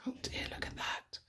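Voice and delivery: soft unvoiced whisper; whispered aside. Reader whispering an aside to children. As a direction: whisper it to the little listeners.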